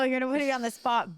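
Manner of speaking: ordinary talk, nothing marked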